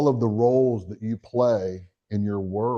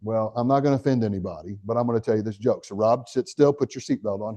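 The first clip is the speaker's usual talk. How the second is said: ordinary talk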